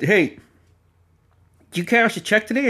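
high, pointed questioning uncle; suspicious uncle. Retold or imitated. Uncle confronting nephew about the check, suspicious. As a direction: voice the uncle fishing for the truth.

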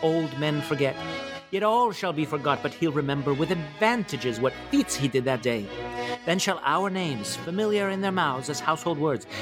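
stirring king's speech to his soldiers; amateur Shakespeare recital. Acted. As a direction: declaim it grandly, lifting key phrases high. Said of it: "presented to you by me in my".

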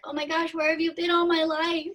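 high-pitched, gushing quote of her smitten self; retold gushing wonder. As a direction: gush high, as if love at first sight. Retelling first sight of newborn.

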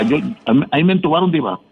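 ordinary delivery

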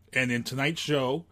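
ordinary talk

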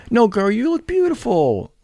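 put-on high, slow, sugary girlfriend voice; mock girly praise. Mocking how girls flatter each other, high and sugary. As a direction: camp it up like a gushing friend.

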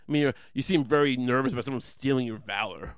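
ordinary delivery

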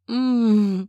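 closed-mouth pleasured moan; muffled moan. A narrator's reading. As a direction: moan through closed lips. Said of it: "I moaned".

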